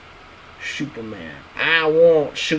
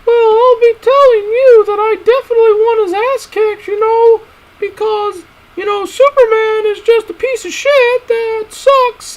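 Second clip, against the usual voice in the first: very high, loud squeaky character voice; squeaky high cartoon voice; 8 dB louder than usual. Vlogger ranting about Superman in a squeaky cartoon voice. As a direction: pitch way up and squawk it like a cartoon critter.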